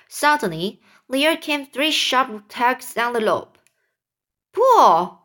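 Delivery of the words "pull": loud, higher-pitched command; shout. Acted. Old grasshopper ordering friends to haul the rope, shouted. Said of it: "shouted the old green grasshopper".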